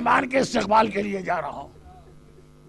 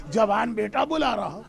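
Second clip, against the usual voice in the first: ordinary talk, nothing marked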